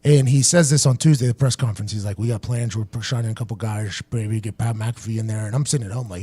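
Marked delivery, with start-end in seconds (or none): 2.2-5.5 low, soft mumbled coach impression; imitating the coach. Coach telling reporters about new signings, low mumble. Retelling coach at press conference.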